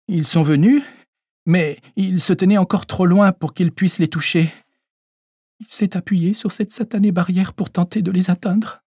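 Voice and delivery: put-on an old lady's voice catching as she retells the shock; hiccuping voice. Old lady tells the doctor what happened, voice catching. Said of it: "d'une voix hoquetante".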